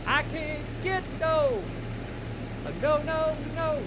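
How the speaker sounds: high falsetto singing voice; girl voice. Pretend. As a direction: sing it in a high put-on girl voice. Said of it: "my girl voice sounds horrific".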